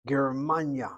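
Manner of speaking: "Germania" as ger-MAN-ya, MAN hit; second-syllable stress. Shown as a demonstration. Teacher modelling the word, "Germania" stressed on MAN. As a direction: land firmly on MAN in "Germania". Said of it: "Hit the second of the three syllables".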